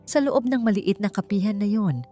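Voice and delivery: ordinary delivery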